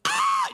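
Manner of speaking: very high-pitched imitated scream; short, piercing mock scream. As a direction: scream like a wild kid. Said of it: "just scream on the top of his lungs".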